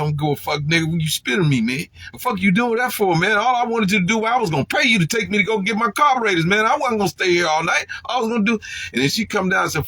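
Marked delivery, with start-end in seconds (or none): 0.0-8.6 high, loud, fast indignant rant; furious retold shouting match; angry retold rant. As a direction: tell him off for spitting on you. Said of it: "I said".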